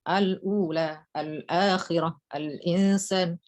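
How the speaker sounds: each word drawn out slowly and evenly; slow tahqiq reading. Shown as a demonstration. Teacher demonstrates tahqiq reading to students, slow and careful. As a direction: recite slowly with full hamza. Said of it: "tahqiqnya gimana?".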